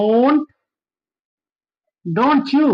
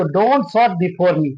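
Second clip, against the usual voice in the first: slow model sentence; 5 semitones lower, much slower than usual. Shown as a demonstration. Teacher models sentence slowly.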